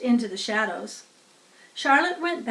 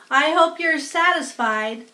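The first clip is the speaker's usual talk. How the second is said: louder, higher sneer from the rat; 6 semitones higher, 6 dB louder than usual. A narrator's reading. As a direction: sneer it out like a put-upon rat. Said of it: "sneered the rat".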